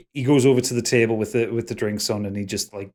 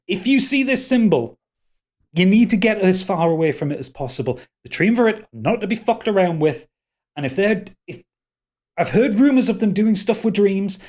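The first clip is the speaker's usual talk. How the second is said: high, agitated pleading; urgent warning; 8 semitones higher, 4 dB louder than usual. Acted. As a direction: impress the danger on them. Frightened character warns about a symbol.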